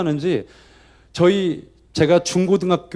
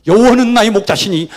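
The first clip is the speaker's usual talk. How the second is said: liturgical recitation; 4 semitones higher, 7 dB louder than usual. Retold or imitated. Congregation reading psalm aloud.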